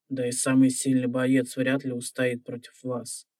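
usual speaking voice

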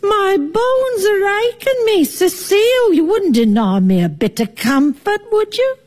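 high, whiny, put-upon invalid; milk the aches for pity; feeble old auntie. Acted. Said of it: "do your feeble auntie a kindness".

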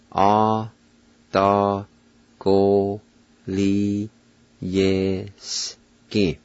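"Atagoliyeski" very slow, every syllable held and set apart; syllable-by-syllable pronunciation. Shown as a demonstration. Teacher pronounces Cherokee word for test, "Atagoliyeski" very slowly in separate syllables. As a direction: break "Atagoliyeski" into long syllables with pauses between. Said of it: "now I'll pronounce them".

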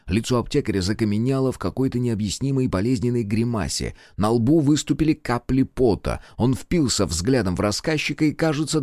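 ordinary delivery, nothing marked

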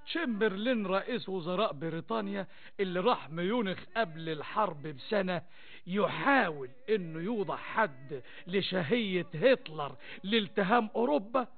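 usual speaking voice

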